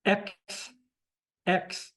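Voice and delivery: "X" as ECKS with a distinct final s; S sounded clearly. Shown as a demonstration. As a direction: sound out "X" alone, hissing the S. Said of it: "there's an S on that".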